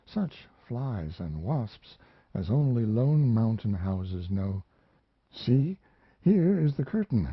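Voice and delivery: put-on a lonely young woman remembering, a little lifted; young woman's voice. Mariana speaks to the narrator.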